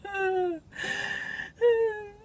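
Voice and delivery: very high laughter tipping into sobs; hysterical laugh-cry. Acted. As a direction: laugh until it turns into crying. Laughter tips into crying.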